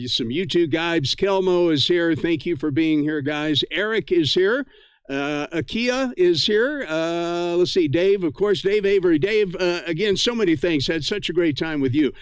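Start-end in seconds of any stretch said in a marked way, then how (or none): none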